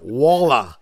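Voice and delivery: "Waller" as the others say it; mimicked pronunciation. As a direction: imitate their take on "Waller". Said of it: "the way you guys say it is like".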